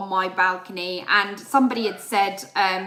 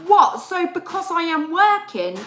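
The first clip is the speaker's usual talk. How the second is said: high, loud disbelieving challenge; incredulous retort; 8 semitones higher, 5 dB louder than usual. Retold or imitated. Retelling her retort to a complaining neighbour, incredulous. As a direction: throw it back in disbelief.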